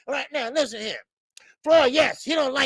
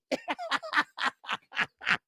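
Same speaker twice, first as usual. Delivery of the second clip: giddy laugh; 8 semitones higher, 7 dB quieter than usual. Retold or imitated. Voiced fighter laughing giddily.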